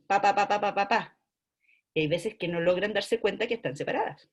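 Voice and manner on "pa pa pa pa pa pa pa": pa pa pa diketuk merata seperti ketukan; rhythmic syllables. Shown as a demonstration. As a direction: ketuk setiap suku kata pa secara merata. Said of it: "suenan de corrido".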